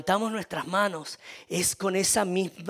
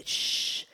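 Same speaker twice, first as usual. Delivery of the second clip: long, voiceless shushing hiss; hushing shh. Retold or imitated. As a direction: shush someone who is shouting. Crowd hushing the shouting man.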